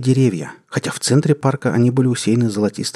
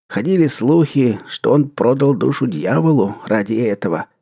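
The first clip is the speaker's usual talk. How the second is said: put-on old usher voice; 3 semitones higher than usual. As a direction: tell the legend with relish. Old usher tells legend.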